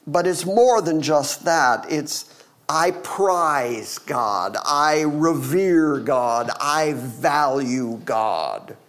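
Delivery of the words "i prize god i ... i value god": very slow, each phrase weighed; slow reverent. Acted. Preacher voicing Jesus's devotion to God, very slow. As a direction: let every word land with reverence.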